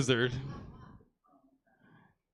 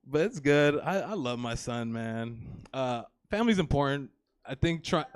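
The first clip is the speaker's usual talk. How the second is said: ordinary talk, nothing marked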